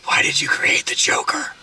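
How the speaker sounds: whispered accusing question; mock whisper. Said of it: "people whispering".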